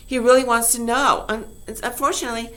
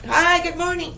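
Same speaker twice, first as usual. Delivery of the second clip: high, bright-voiced hellos; chirpy hellos to neighbors, acted out; cheerful neighbor greetings; 7 semitones higher than usual. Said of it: "They go like that".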